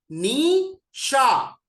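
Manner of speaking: very slow, drawn-out नी शा; syllables नी शा. Shown as a demonstration. Teacher demonstrates नी शा very slowly. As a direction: stretch नी and शा out. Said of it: "दोनों ही sound खराब है".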